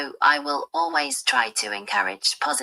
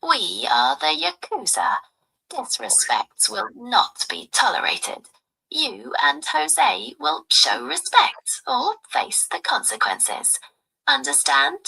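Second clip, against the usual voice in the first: higher-pitched stern crime-family wife; Yakuza wife; 10 semitones higher than usual. Pretend. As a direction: lightly play a Yakuza wife demanding respect. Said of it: "I want you to role play".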